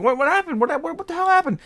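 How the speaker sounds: high-pitched, loud mock panic; frantic imitation of a coworker pleading with the cat; freaking out. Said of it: "they start freaking out".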